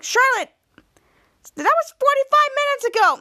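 high-pitched mock protest; mock complaint. Acted group complaint at Charlotte.

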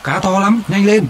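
high excited call. A narrator's reading. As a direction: urge them to hurry. Boy shouting from riverbank.

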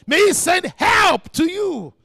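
loud, high, slow proclamation; shouted blessing. Real, not acted. Preacher blessing those in need, in a loud slow cry. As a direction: call it out slowly over the whole congregation.

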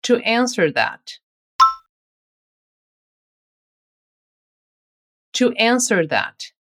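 slow, careful To answer that; slow model phrase. Shown as a demonstration. Teacher modelling To answer that slowly for repetition. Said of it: "colocar essa palavra naquele trechinho".

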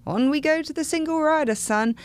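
high, breezy dad voice; a cheerful dad steering his son along; dad's cheery voice. Retold or imitated. Dad leading son to the ride, high and breezy.

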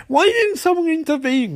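high-pitched incredulous protest; mock outrage. Joe questioning others about the Trickster, mock outraged. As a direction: start very high, demanding an answer.

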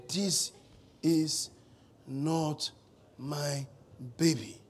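put-on This, is, not, my, baby, each word spoken alone after a pause. Shown as a demonstration. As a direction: spell it out slowly, one word at a time. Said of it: "So she woke up and said".

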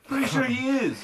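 high, loud imitating show host. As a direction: echo the host voice to mock it.

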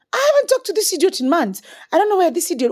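high, exasperated outburst at the idiot; exasperated rant. Retold or imitated. Searching her phone for David, high exasperated rant. Retold irritated thoughts about David.

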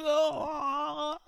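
imitated high crying wail; dying-kid wail. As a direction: wail like a child in mock agony. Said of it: "like he's dying or something".

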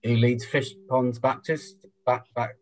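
normal talking voice

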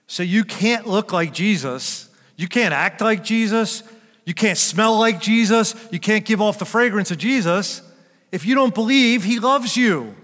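fiery pulpit build, pressing the congregation; preaching at full force. Acted. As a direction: preach it hard to the room, hammering each phrase.

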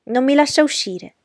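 put-on loud, high childish wail; yelled complaint. A narrator's reading. Boy telling on his cousin, yelled complaint. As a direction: yell it as an accusation. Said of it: "urlò Francis".

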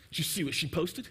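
low, soft gossipy question; hushed gossip. Acted. Man gossiping about someone's post, hushed and low. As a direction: confide it like juicy scandal. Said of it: "I'm gonna say something along the lines of".

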